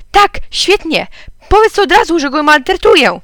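high, loud sarcastic outburst; angry retort. A narrator's reading. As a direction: fire back at your husband, voice up. Mother retorts in parents' quarrel. (głośna, wysoka, oburzona riposta)

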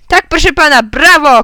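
loud, high congratulation; radio host's shout. A narrator's reading. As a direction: cry bravo like a quiz host. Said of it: "krzyknął pan z radia". (głośny, entuzjastyczny okrzyk prezentera)

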